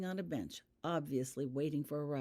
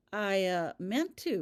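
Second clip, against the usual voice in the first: guiltily; 4 semitones higher, 6 dB louder, slower than usual. A narrator's reading. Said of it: "replied Rita guiltily".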